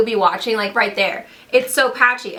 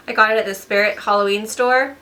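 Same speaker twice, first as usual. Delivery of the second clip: normal talking voice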